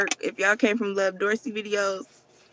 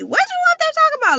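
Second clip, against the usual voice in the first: much higher, fast mimic of her mother; nosy mom; 4 dB louder, faster than usual. Mocking her mom overhearing her recordings, high and quick. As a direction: squeak it out quick like a prying mom. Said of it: "'cause she gonna be".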